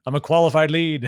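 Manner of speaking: mock insistence. Applicant wanting to shorten the cycle, mock insistence. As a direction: protest your case with comic force. Acts out an eager foster applicant.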